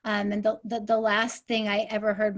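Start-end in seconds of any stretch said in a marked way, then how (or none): none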